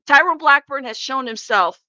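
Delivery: ordinary talk, nothing marked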